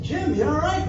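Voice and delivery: worried high call over the noise; retold yell. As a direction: call out high across the water. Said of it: "I yelled".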